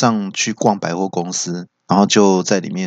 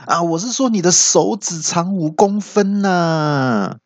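high, stretched protest; mock outcry; 8 semitones higher, slower than usual. Old uncle defending himself to the friend, crying out. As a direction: wail the excuse, dragging out the end. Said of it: "阿北就大叫说".

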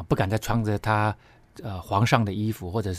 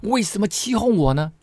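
你，你，你 cried out high and loud, stammering; a shocked medium shrieks at the disguised king; loud cry; 10 semitones higher than usual. Acted. Medium cries out at Saul, shocked, high and loud. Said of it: "就大声呼叫说".

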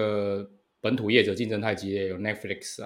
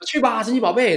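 loud, high battle cry; anime hero shout; 13 semitones higher, 8 dB louder than usual. Pretend. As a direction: call it like a Pokémon trainer. Quotes Pokémon trainer catchphrase.

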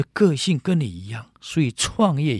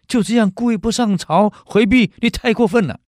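accusing critics; 7 semitones higher, 7 dB louder than usual. Acted. Critics accuse Cao Can.

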